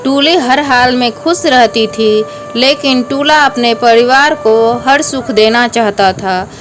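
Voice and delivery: ordinary delivery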